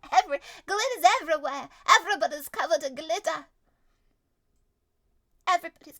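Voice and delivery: loud, fast, high excited outburst; excited yell. Acted. GM yelling excitedly about the glitter explosion. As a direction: shout the chaos out gleefully.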